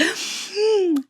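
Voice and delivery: sharp high gasp of shock; startled gasp. Retold or imitated. Reader on her walk reacting to the ending, a high gasp. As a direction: gasp as if the book just hit you. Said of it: "I was on my walk and I'm like".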